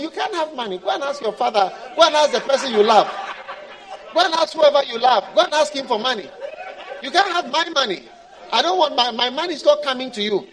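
loud refusal through laughter; laughing brush-off. Acted. God laughing off the believer's begging. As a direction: laugh them off while saying no.

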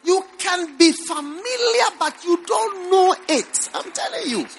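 high, pressing pulpit voice; fervent preaching, voice climbing. Acted. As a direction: drive the point home to the crowd.

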